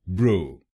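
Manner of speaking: ब्रो said as a single clear syllable bro; first syllable alone. Shown as a demonstration. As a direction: isolate ब्रो slowly and clearly. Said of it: "पहले शब्दांश को बोलिए ब्रो". (पहला शब्दांश ब्रो अलग से)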